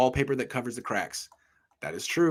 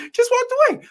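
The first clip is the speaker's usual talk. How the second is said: incredulous; 6 dB louder than usual. Retold or imitated. Retelling teammate walking off.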